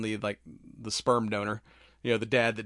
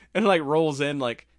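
ordinary delivery

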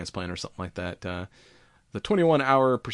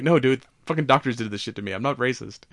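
defensive patient; 4 semitones higher, 6 dB louder than usual. Retold or imitated. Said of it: "a fucking Chinese accent".

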